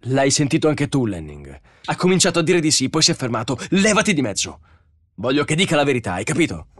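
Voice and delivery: loud, high, heated protest; angry quarrel. A narrator's reading. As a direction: shove past an opponent with the voice. Bogart quarrels with Lanning.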